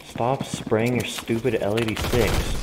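usual speaking voice

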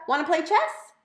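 high, loud and eager; a bouncy kid blurting it out; excited little sister. A narrator's reading. Little sister gleefully answering her brother, high and loud.

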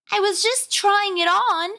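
put-on very high, slow little-kid whine; squeaky, drawn-out childish protest; bratty younger brother. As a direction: whine like a small boy. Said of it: "This is his voice".

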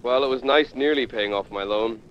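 deadpan. Real, not acted. Resigned joke as he goes down.

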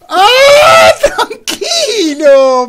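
high startled scream into laughter; shriek, then laugh it off. Real, not acted.

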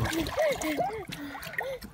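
high, delighted laugh; high burst of laughter. Real, not acted. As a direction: laugh out at the mishap. Laughing at the slide.

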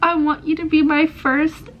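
high, slow, tearful voice close to crying; about to cry. Real, not acted. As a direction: let the voice crack as tears come. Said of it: "I'm gonna cry".

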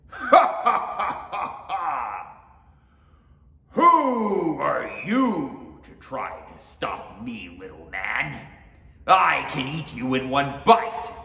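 big slow laugh into a loud sneer; scornful ogre. A narrator's reading. Oni scoffing at the little samurai, loud scorn. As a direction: boom it, stretching the words in mockery.

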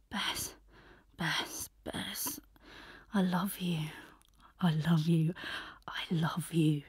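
soft whispered repetition; murmured. A narrator's reading. Boy murmuring into Bess's hair, whispered. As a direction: breathe it into her hair.

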